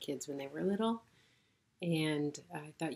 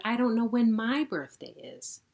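1 insistent explaining; 5 semitones higher, 7 dB louder than usual. Acted. Rabbit explains, louder.

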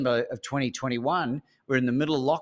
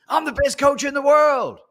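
high, loud mock proclamation; imitated bellow, pitched way up; Steve Hardison proclaiming; 12 semitones higher, 8 dB louder than usual. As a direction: shout the boast out to everyone. Steve's retold boast, shouted.

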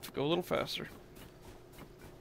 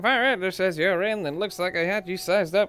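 reporter voice; 4 semitones higher, 7 dB louder than usual. Acted.

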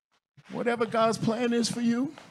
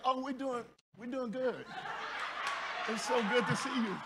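softer, giggly fawning over the pastor; silly starstruck gushing; 5 dB quieter than usual. Retold or imitated. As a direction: gush with a nervous giggle. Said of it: "I was acting silly in front of him".

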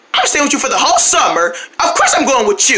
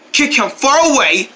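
ordinary talk

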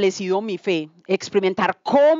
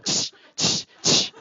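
shushing hiss; much slower than usual. Pretend. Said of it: "yo con esta voz".

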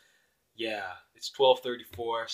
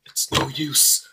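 hopeless despair; slower than usual. Acted. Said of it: "you might wanna calm down there".

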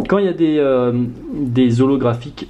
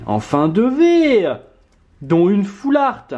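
excited exclaim; 7 semitones higher, slower than usual. Real, not acted. Repeat with rising joy.

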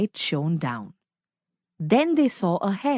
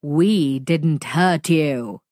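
deep booming voice; 5 semitones lower, slower than usual. Acted. Monster demanding why the friends attacked, deep booming. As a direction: boom it like a big alien.

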